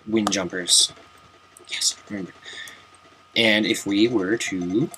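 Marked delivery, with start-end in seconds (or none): 1.7-2.3 whisper. Pretend. Answering himself in an aside.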